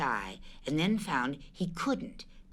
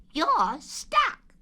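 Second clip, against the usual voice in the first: high, lively Rabbit voice; high fussy rabbit; Rabbit's voice; 14 semitones higher, 5 dB louder than usual. Acted. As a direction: pitch Rabbit up, brisk and fussy. Rabbit voiced in read story.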